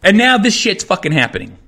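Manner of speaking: ordinary talk, nothing marked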